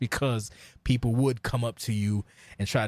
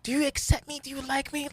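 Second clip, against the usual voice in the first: much higher, pleading mock whine; mocking needy voice. Mocking clout-chasers online, high and pleading. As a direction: parody someone begging for approval.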